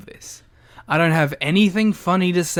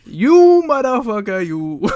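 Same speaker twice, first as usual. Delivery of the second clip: loud, higher mock-grand curse; mystical D&D character; 4 semitones higher, 6 dB louder, slower than usual. Co-host carries on the mystic send-up, loud mock-grand voice. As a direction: declaim the insult with hammed-up grandeur. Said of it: "the voice he gave whatever mystical character".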